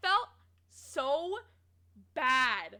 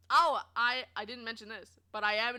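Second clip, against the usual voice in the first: ordinary talk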